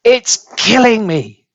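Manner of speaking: high, outraged wail, slowing at the end; outraged old woman. A narrator's reading. Grandma screams at George that the medicine is killing her. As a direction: scream it like it's killing you. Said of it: "she screamed".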